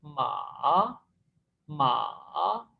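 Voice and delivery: माँ drawn out, dipping low then rising back; third tone, dipping. Shown as a demonstration. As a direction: slowly sink on माँ, then swing back up. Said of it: "नीचे जाएगी और फिर वापस".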